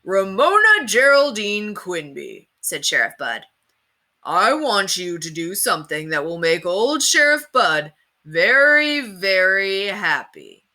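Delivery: put-on hammy children's TV sheriff, slow and booming; TV cowboy host. A narrator's reading. Sheriff Bud to kids in TV land, hammy host voice. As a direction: sell it to the kids like a TV huckster.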